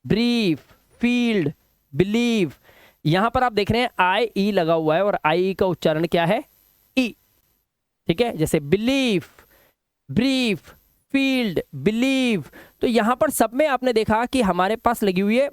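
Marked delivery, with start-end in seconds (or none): 0.1-2.5 "ब्रीफ, फील्ड, बिलीव" each with a drawn-out ee; word-by-word model, long ee in "ब्रीफ, फील्ड, बिलीव"; long E vowel. Shown as a demonstration. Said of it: "long vowel sound ई". 8.6-12.4 "बिलीफ, ब्रीफ, फील्ड, बिलीव" with stretched ee; slow word list, long ee in "ब्रीफ" and "फील्ड"; long E vowel. Shown as a demonstration. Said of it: "long vowel sound ई".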